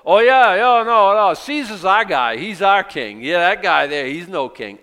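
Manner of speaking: loud, high sarcastic mimicry; mocking priests. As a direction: ham up their eager fake loyalty. Preacher mocks the chief priests.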